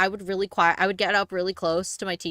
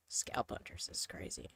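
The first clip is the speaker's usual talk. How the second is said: whispering; 13 semitones lower, 17 dB quieter than usual. Real, not acted.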